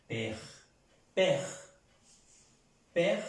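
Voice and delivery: "père" as one syllable with open è; French pronunciation demo. Shown as a demonstration. Teacher showing an example of accent grave, French pronunciation demo. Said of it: "accent grave".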